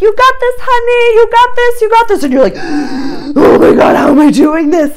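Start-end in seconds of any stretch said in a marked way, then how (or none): 0.0-2.2 much higher, louder hyped coach chant; spin instructor cheer. Retold or imitated. Spin instructor cheering the class on, high and loud. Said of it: "you know those spin instructors". 2.6-3.3 gasp. Pretend. Shocked at own ability. 3.4-5.0 breathless, louder, higher marvelling; amazed disbelief. Pretend. Rider amazed at keeping up with the instructor, breathless.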